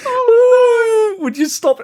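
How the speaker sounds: loud high ooh; excited squeal. Real, not acted. Excited at pack opening.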